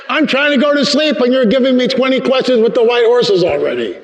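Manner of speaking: high, whining protest in one long run; mock-grumble at being kept awake; exasperated complaint. Man kept from sleep by the Holy Spirit's questions, exasperated.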